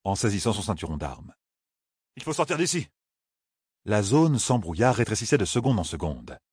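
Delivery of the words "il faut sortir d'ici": voix plus aiguë, urgente; pousse-les à partir; Rand pressant. A narrator's reading. (Rand presse les autres de fuir)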